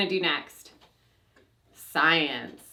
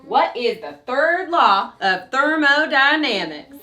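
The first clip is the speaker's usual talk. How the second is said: loud, drawling cowboy recitation; 3 semitones higher, 9 dB louder than usual. Pretend. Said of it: "Gotta do it in cowboy voice".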